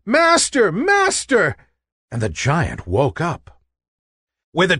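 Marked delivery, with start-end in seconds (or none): 0.0-0.7 high, ringing cry for help; calling out. A narrator's reading. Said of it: "the harp called out". 0.7-1.5 high, ringing cry for help; calling out. A narrator's reading. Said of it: "the harp called out".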